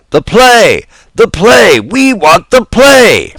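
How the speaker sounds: very high, loud, slow chant of demand; angry yell. Acted. Angry audience demanding the play, loud chant. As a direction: bellow it like an angry crowd. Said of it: "became angry and began to yell".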